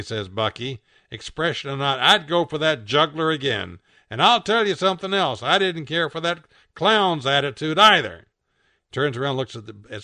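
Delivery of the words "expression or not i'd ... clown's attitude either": loud, rising, defiant complaint; indignant rant. A narrator's reading. Bucky defends himself to Rob, indignant. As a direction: build into a feisty grumble.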